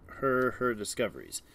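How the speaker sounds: ordinary delivery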